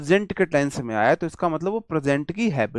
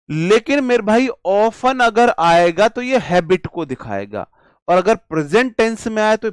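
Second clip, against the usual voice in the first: emphatic teacher; 5 semitones higher, 6 dB louder than usual. Real, not acted. Teacher stressing key point.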